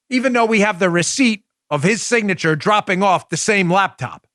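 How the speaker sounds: ordinary talk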